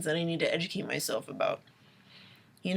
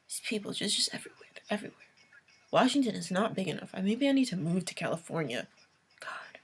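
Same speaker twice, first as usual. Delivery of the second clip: whispered complaints; hushed, muttering to herself; whispered late-night venting; 7 dB quieter, faster than usual. Real, not acted. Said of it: "whispering after the rant".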